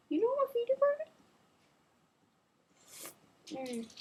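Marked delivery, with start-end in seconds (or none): 0.1-1.1 very high fast squeak; squeaky mock question. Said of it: "He was just like".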